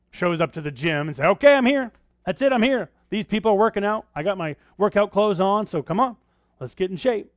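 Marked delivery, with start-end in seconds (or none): 1.3-7.3 loud, high, pumped-up gym newbie; excited gym-goer. Retold or imitated. Preacher mocks a gym-goer who only shows up, loud and high. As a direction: ham up a clueless guy proud just to show up.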